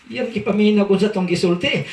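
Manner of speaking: usual speaking voice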